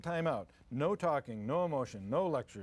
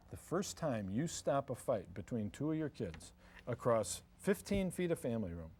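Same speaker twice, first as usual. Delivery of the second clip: ordinary delivery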